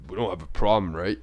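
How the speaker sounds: low, quiet mock challenge; confrontational tone. Shown as a demonstration. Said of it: "he didn't say it in a tone like".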